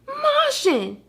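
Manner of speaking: high-pitched cry of the name; cried out. Acted. Puppy crying out to the Martian, high alarm. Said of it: "cried Frosty".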